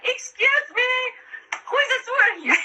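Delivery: high, laughing mock plea to passers-by; mock asking strangers. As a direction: pretend to ask the street for a sewer, half laughing. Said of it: "you go out of the house like".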